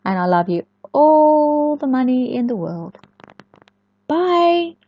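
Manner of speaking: ordinary delivery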